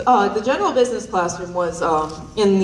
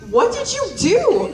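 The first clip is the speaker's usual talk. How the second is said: much higher, slow incredulous mom; amused mom; slower than usual. Retold or imitated. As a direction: ask it high and slow, amazed. Mom voiced in story.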